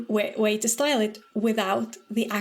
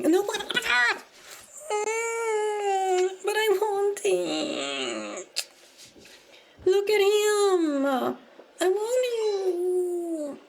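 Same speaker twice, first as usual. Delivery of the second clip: high, drawn-out pleading whine; whiny longing; 9 semitones higher, much slower than usual. Real, not acted. Streamer wanting a cat she can't catch, whiny longing. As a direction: stretch each word out, pining for it.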